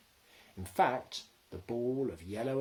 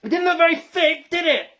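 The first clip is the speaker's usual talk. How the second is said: shout; 16 dB louder than usual. A narrator's reading. Shouted exchange in lorry.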